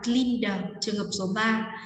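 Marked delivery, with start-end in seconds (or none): 0.0-0.6 "cleaner" said as "cleaned" with a released "đờ"; đuôi -ed đọc /d/. Shown as a demonstration. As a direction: sound out the final d of "cleaner". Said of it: "chúng ta sẽ phát âm là". (đọc "cleaner" thành "cleaned", bật âm đờ ở cuối)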